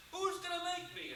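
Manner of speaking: high-pitched cocky comeback; defiant challenge. Retold or imitated. Little heckler challenges Paul, defiant and high-pitched. Heckler answers back in quarrel.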